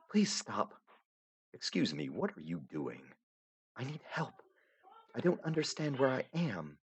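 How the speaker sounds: lightly voiced young heroine, gently insistent; Aradia, softly puzzled. Aradia lost in the void, pleading softly to the narrator.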